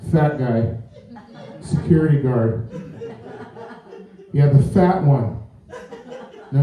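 agent on phone. Pretend. Imagined agent phone report.

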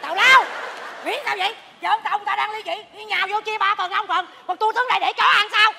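comic outrage; indignant protest. Acted. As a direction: object loudly, as if cheated of a share. Protests at being left out.

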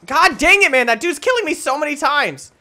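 loud, high ranting; frustrated yell. Real, not acted. Gamer raging at an enemy who keeps killing him, loud. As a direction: rage at the guy killing you.

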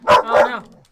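panicked cry. Real, not acted. Panicking in game fight.

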